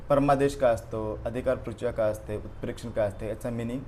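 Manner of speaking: ordinary talk, nothing marked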